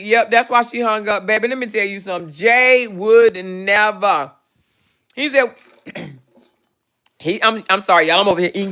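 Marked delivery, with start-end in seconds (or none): 2.3-4.3 Jay would never said very slowly, each word drawn out; slow emphatic. Real, not acted.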